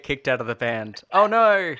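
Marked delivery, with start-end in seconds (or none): 1.1-1.8 mock dismay. Mock dismay at being kicked out.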